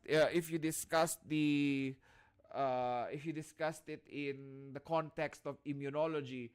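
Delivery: ordinary talk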